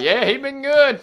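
high, put-on coworker voice vouching; imitating coworker. Coworker vouching to the wife, high mock voice. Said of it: "he was like".

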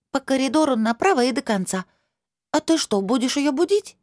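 put-on high child voice, worried at the end; a small girl fearing the outcome; little girl voice. Girl gives directions, worried.

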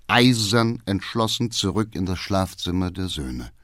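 ordinary delivery, nothing marked

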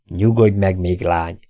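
plain reading voice

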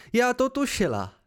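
high, triumphant exclamation; victorious, pitched well up; triumphant cry. A narrator's reading. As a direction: cry it out like a win. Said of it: "vykřikla vítězně".

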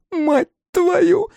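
very high, loud squawked curse; piercingly high exclamation; alien crowd voice. A narrator's reading. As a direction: shriek the curse in another monster's voice. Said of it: "чужих и настоящих".

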